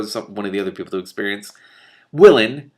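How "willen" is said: loud call across the hall; shout. A narrator's reading. King shouting for his steward, loud call. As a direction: shout the name to summon him. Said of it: "Mendembar shouted".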